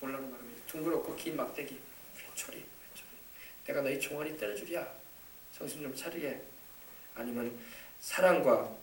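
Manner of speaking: soft, low pastoral exhortation; tender. Real, not acted. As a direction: gently coax the congregation.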